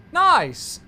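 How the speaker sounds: high, loud burst of delight; pleased cheer. Real, not acted. Streamer cheering after the controller accepts the readback, a loud high burst. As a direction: celebrate the clean readback.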